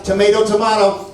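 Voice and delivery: "Tomato" as to-MAY-to then to-MAH-to; first "Tomato" with ay, second with ah; two ways to say tomato. Retold or imitated. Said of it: "anybody says".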